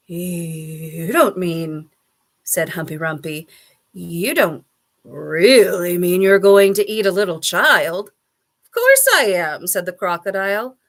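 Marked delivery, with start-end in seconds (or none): 0.1-1.9 drawn-out, dreading 'you'; appalled disbelief. A narrator's reading. Humpy Rumpy questions the crocodile, slowly appalled. 3.9-8.1 loud, incredulous question; horrified disbelief. A narrator's reading. Humpy Rumpy challenges the child-eating crocodile, loudly horrified. 8.6-9.7 high, loud, gleeful admission; brazen boast. A narrator's reading. Crocodile answers Humpy Rumpy, brazenly boasting.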